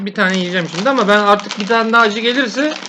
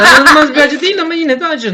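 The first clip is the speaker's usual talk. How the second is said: laughing; 5 semitones higher, 5 dB louder than usual. Real, not acted. Laughing admission, louder.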